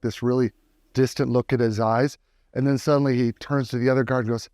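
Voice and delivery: ordinary talk, nothing marked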